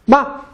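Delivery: "Ma" very high and short; neutral-tone question particle. Shown as a demonstration. Teacher answering own quiz, short high "Ma". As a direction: pop out a brief high "Ma". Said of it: "what do I add?".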